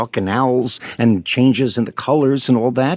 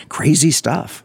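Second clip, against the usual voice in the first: whispered aside; 3 semitones higher, slower than usual. Real, not acted. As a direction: breathe it as a quick aside. Host's aside before the guest answers.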